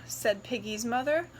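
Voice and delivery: ordinary delivery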